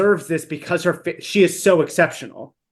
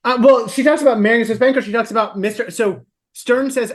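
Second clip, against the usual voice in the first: normal talking voice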